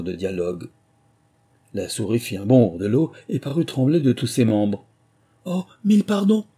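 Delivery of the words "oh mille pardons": higher, louder, hurried apology; flustered, high-pitched apology; quick apologetic exclamation. A narrator's reading. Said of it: "s'écria vivement Alice, qui craignait d'avoir fait de la peine".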